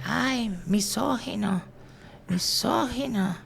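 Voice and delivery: high, soft mock heckle, the last word drawn out; drunk lady heckling. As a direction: whine it like a tipsy heckler. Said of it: "empezó a gritar".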